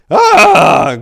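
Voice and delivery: sharp loud shocked "Ah!" cry; a burst of horror at the sight; startled cry. A narrator's reading. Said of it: "cried Father Dunnigan".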